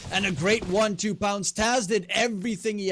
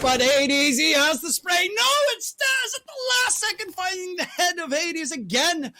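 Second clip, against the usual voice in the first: excited shout; 4 semitones higher than usual. Real, not acted. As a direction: scream the winning shot. Caster calls the final kill.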